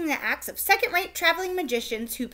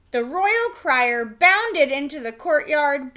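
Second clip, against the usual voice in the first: plain reading voice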